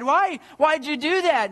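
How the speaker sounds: high-pitched, pleading protest; a daughter crying out against her loss; distressed cry. A narrator's reading. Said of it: "she cried".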